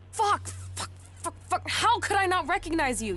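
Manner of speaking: high-pitched burst of frustrated cursing; exasperated swearing. Acted. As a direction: curse in mounting disbelief at yourself. Swearing at realizing who he is.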